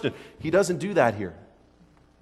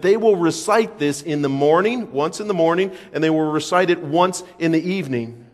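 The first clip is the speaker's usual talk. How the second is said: normal talking voice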